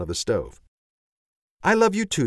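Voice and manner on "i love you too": tender. A narrator's reading. Mother answers son tenderly.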